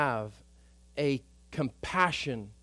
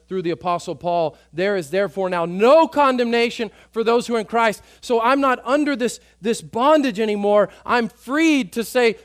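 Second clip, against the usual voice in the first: louder, higher preaching at full force; fervent preaching; 7 semitones higher, 10 dB louder than usual. Acted. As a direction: drive it home to the congregation.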